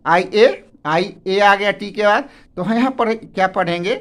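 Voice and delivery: ordinary delivery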